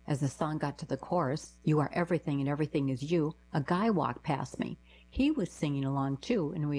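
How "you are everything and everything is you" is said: ordinary delivery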